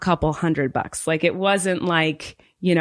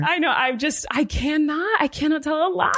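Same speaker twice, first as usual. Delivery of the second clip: high, emphatic comic wail; playful insistence in a high voice; helpless protest; 9 semitones higher than usual. Real, not acted. Comic protest about being unable to lie.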